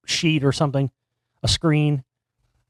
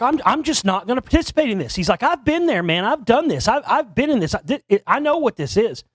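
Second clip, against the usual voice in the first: raised, heated voice, imitating a man losing his temper; retold angry outburst; 7 semitones higher than usual. Marty storming out of the reenacted session, imitating his heated rant. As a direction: replay his rant with rising pitch and heat. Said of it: "And he starts getting pissed".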